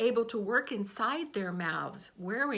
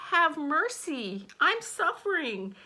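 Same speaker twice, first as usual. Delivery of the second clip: wailing; 7 semitones higher, 4 dB louder than usual. A narrator's reading. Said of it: "the fox wailed".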